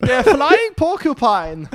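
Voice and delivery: very high, loud, drawn-out camp German; hammed-up falsetto German accent. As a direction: shriek it in a campy German accent. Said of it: "they always sound so camp".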